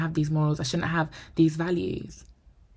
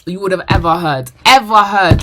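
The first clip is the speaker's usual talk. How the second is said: loud EVER HEARD, louder still on the repeat; emphatic repeat; 13 dB louder than usual. Real, not acted. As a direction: punch "ever heard" twice, building in volume. Stressing how loud the sound was.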